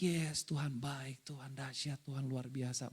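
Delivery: low, soft, slow murmured praise; happy murmuring. Retold or imitated. Preacher imitating mom murmuring praise while cooking, low happy murmur. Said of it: "Ini bergumam bisa dua konotasi".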